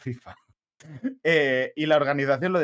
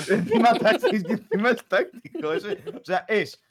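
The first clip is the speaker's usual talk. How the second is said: laughing; 7 semitones higher than usual. Real, not acted. Mocking a co-host's remark.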